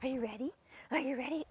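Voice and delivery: usual speaking voice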